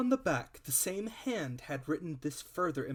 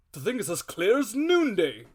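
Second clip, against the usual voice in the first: high, loud, excited exclamation; the squire bursting out with certainty; booming, triumphant cry; 7 semitones higher, 12 dB louder than usual. Acted. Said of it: "cried the squire".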